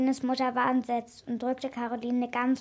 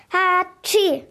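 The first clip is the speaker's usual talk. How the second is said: big, high "hatschi" sneeze; loud acted sneeze; 6 semitones higher, 8 dB louder than usual. A narrator's reading. Caroline gives herself away while eavesdropping, loud sneeze. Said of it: "musste Caroline laut niesen".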